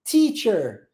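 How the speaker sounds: "Teacher" starting with a crisp ts-like T; tongue behind teeth for a hissed T in "Teacher"; carefully modelled English "Teacher". Shown as a demonstration. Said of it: "a língua vem atrás do dente".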